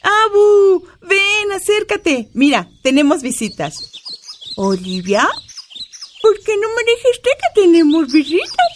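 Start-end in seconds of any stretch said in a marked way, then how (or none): 0.0-2.3 put-on high, thin old woman voice; old grandma voice. Grandma character speaking. 6.2-8.7 put-on high, thin old woman voice; old grandma voice. Grandma character speaking.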